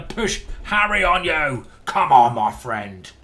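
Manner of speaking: loud, rowdy battle cry to the opponent; carried away. Real, not acted. Chess streamer taunts opponent while pushing a pawn, carried away. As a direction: get carried away, building into a big drawn-out shout. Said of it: "a little bit carried away there".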